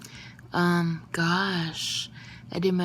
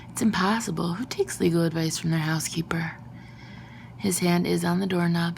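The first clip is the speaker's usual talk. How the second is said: ordinary delivery, nothing marked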